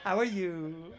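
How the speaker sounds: put-on sweet host voice; fake friendly greeting. Greeting guests mid-fight, fake pleasant. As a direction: switch to fake cheer at the door. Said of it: "Gotta change your whole tone".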